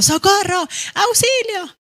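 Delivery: high loud cries of Socorro and Auxilio; urgent cries for help; crying for help. Shown as a demonstration. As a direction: shout as if in danger.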